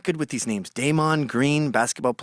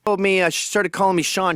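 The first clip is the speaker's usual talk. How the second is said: ordinary delivery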